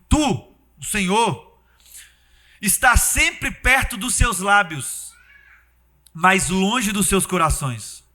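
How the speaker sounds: slow, deliberate verse reading; slow scripture reading. Real, not acted. Preacher reads the prophet's words to God, slow and deliberate. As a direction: weigh every word of the verse. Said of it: "reading scripture slowly".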